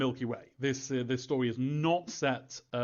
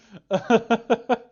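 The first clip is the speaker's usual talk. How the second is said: big laugh; 9 semitones higher, 9 dB louder than usual. Real, not acted. Giggling at own Q&A answer.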